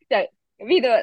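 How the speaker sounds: breathy laugh breaking into high words; half laugh, half tears, pitch jumping up; laughing through emotion. Real, not acted. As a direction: laugh it off while moved. Laughing while moved.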